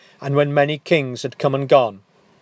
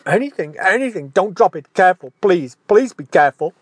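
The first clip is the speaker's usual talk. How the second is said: higher, louder frantic begging of a terrified giant; panicked pleading; 6 semitones higher, 7 dB louder, faster than usual. Acted. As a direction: beg him frantically not to drop it. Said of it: "voice disintegrates into a sorry broken chord".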